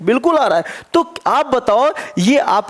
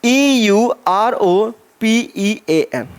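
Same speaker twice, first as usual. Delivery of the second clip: letter by letter, slow; teacher spelling a word for the class, slow and deliberate; spelled out slowly; much slower than usual. Shown as a demonstration. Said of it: "एक word मैंने कहा".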